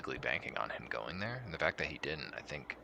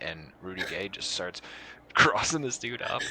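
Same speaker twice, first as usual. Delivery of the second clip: usual speaking voice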